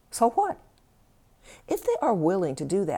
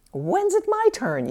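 higher, louder, slowed yell of frustration; inner yell; 6 dB louder than usual. Acted. Overworked partner yells at the one who never helps, a high loud yell. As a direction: yell it out like you've finally had enough. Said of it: "you yell".

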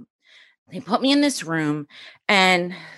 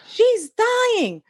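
very high-pitched mock shriek; imitated screaming; 14 semitones higher than usual. Best friend screams at husband before the kids, mock shriek. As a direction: play a hysterical friend, voice way up. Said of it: "my best friend starts screaming".

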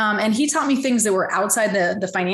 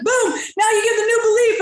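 very high-pitched, exuberant burst; hyped-up proclamation; 14 semitones higher, 4 dB louder than usual. Retold or imitated. Seminar leader at the turnaround moment, mock-euphoric. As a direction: announce a sudden miracle, hammed up.

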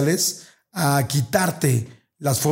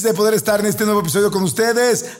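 higher, upbeat host greeting; show opening; 6 semitones higher than usual. Acted. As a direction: welcome the listeners like a radio host. (saludo animado de locutor)

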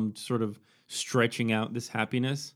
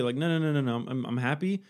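ordinary delivery

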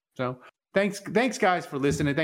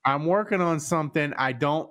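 ordinary talk

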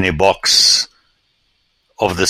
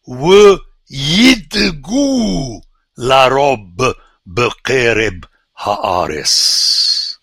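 slow recitation; 5 semitones higher, much slower than usual. Real, not acted. Reciting the Hebrew original.

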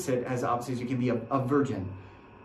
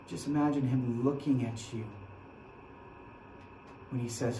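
soft, hushed guided imagining; quiet guided meditation; 3 semitones lower, 6 dB quieter than usual. Acted. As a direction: draw them quietly into the scene. Guides an imagined scene at the cross.

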